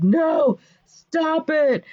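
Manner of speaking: high, loud villain protest; theatrical cry to halt; mock alarm. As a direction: plead in hammed-up panic. Villain voiced hearing the counter curse.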